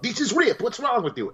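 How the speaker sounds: high-pitched mock outrage; high indignant mock manager. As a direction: scold the waiter in a high mock voice. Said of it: "the manager comes up".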